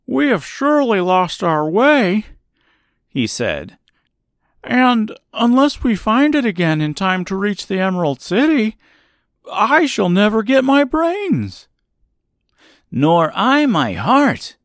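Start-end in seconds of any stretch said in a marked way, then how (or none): 0.1-2.3 high-pitched grumbling scarecrow voice. A narrator's reading. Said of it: "began to grumble a bit". 4.6-11.7 high, louder complaint as a scarecrow; grumbling scarecrow. A narrator's reading. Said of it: "began to grumble a bit". 12.9-14.6 slow, louder, higher declaration; declaring Tin Woodman. A narrator's reading. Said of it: "declared the Tin Woodman".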